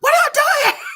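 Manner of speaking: high, loud, shrieking disbelief; shocked annoyed squeal. Retold or imitated. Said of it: "I was so annoyed".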